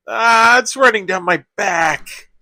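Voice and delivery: high-pitched cry at icy snow down the back; yelping complaint. Acted. Snow falls down speaker's back.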